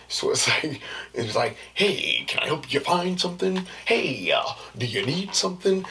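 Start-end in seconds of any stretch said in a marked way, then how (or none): 1.7-3.6 high, loud customer-service chirp; fake work voice. Shown as a demonstration. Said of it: "this voice comes out of me". 3.8-5.8 loud, swooping customer-service greeting; fake work voice. Shown as a demonstration. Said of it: "why I talk like that".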